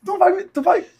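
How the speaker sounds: high, loud imagined outburst; loud, incredulous mock scolding; mock outrage. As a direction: imitate a father exploding in disbelief. Imagined angry reaction of father.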